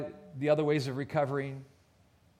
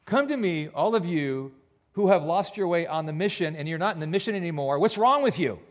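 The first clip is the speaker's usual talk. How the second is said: louder, harsh mock rebuke; mock scolding Jesus; 3 semitones higher, 7 dB louder than usual. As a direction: play a Jesus who scolds the lost. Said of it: "Is that what he says? No".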